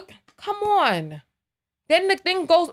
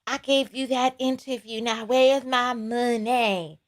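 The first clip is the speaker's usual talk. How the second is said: lower, slow put-on voice asking for money; mock guest demanding pay; 4 semitones lower, 3 dB quieter than usual. Fake guest demanding money from the host, low slow voice. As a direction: lean in and collect your cash. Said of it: "and then they like".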